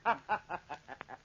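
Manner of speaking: cruel chuckle. Acted. He laughs at her fear.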